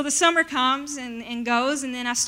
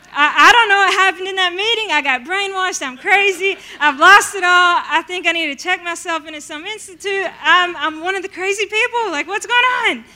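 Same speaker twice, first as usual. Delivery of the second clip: louder, higher half-laughing alarm; frantic laughing panic; 6 semitones higher, 9 dB louder than usual. Retold or imitated. Telling friends she got brainwashed, loud laughing panic. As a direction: laugh at your own craziness, alarmed.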